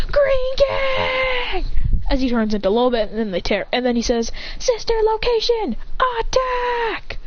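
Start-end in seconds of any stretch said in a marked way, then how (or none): 0.1-1.7 very high, drawn-out shout; shouted battle cry. Acted. Izuku voiced shouting battle cry. 4.6-7.2 high-pitched cry to attack; battle command. Acted. Character voiced ordering an attack.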